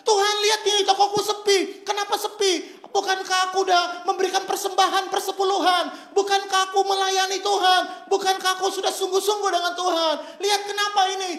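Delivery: preacher mimicking a believer complaining to God. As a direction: whine at God like a wronged churchgoer. Shop owner complains to God.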